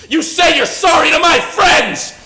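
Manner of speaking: enraged bellow, slowed down; furious shout. Retold or imitated. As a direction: scream the demand, stretching it out. Forcing apology to the TV.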